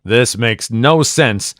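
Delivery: usual speaking voice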